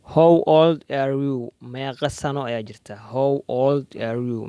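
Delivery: usual speaking voice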